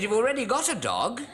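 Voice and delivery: a mock wife voice scoffing; husband mimicking wife's put-down. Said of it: "She said".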